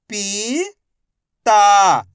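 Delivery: each syllable slowly held, first one rising. Shown as a demonstration. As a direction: break the word into long, separate syllables. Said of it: "एक अक्षर का उच्चारण करके".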